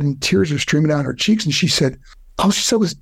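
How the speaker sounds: ordinary talk, nothing marked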